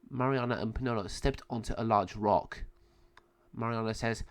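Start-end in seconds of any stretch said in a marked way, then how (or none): none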